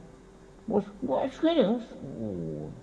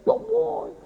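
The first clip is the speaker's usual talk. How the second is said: mock pleading; 6 dB louder than usual. Mocking worshippers' trivial pleas.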